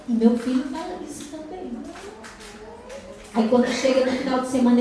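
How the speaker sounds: ordinary talk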